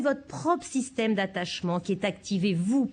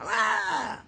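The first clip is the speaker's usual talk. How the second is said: bref cri fâché de bébé; cri de colère de bébé; 2 semitones higher than usual. Shown as a demonstration. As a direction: lancez un cri de bébé furieux. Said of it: "Je vais vous le faire pour la colère". (cri de bébé en colère)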